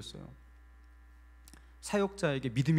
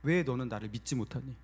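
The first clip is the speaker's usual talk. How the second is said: God speaking gently; 4 semitones lower than usual. Retold or imitated. God questions the praying pastor's faith, low gentle reproach. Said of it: "하나님께서 저에게 말씀하셨어요".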